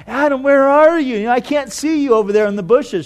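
mock game of looking for someone; hide-and-seek seeker. Shown as a demonstration. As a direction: call out as if playing hide and seek. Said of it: "It wasn't hide and seek".

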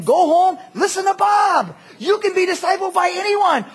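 high, loud, insistent urging; shouted exhortation. Real, not acted. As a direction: drive it home at full volume. Preacher exhorts the excuse-makers loudly.

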